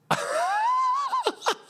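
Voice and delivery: helpless high-pitched cackle; high laughing. Real, not acted. Streamer stuck in his head over Trump, laughing. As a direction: lose it completely. Cracking up mid-attempt.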